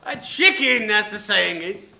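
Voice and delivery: loud, high mocking cry; gleeful jeer. Servant mocking the cowardly Scrub to the robber. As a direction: crow it out with glee.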